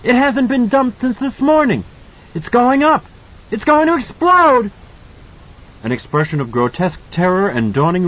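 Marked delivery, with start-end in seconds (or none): 0.0-4.7 put-on high, loud excited child's voice; boy yelling the hotel will blow; triumphant shouting. A narrator's reading. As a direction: gloat in a rising shout. Danny shouts triumphant warning.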